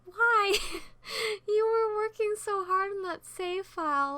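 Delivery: high, loud exasperated outburst; agitated. Retold or imitated. Vlogger replaying their reaction to abandoned save files, agitated. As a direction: cry it out in exasperated disbelief. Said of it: "sometimes I get agitated".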